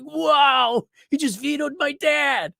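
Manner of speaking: put-on high astonished kid voice; amazed kid. Boy amazed that grandpa vetoed dad, high and loud. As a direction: gasp it out like a wide-eyed child. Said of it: "I was like".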